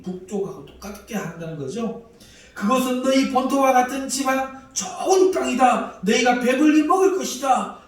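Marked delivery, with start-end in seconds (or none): 2.5-7.8 much higher and louder, with a laugh; loud mocking promise. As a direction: shout the empty promise with a sneering laugh. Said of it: "큰 소리로 말합니다".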